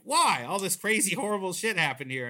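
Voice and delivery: a hammed-up woke scolding; mock outrage. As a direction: scold like an offended critic. Woke critics get mad.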